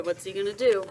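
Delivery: ordinary delivery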